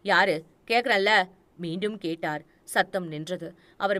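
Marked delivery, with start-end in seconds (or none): 0.0-1.3 loud, insistent call through a door. Acted. Old man shouting at an unseen intruder, loud. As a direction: demand an answer from the dark.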